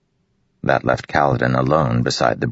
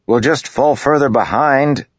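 raised, louder comeback; retorting; 12 semitones higher, 4 dB louder than usual. A narrator's reading. Kaladin retorting to Matal, raised and firm. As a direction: push back at the officer. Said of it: "Kaladin retorted".

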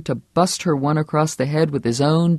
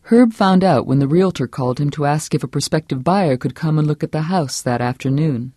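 ordinary talk, nothing marked

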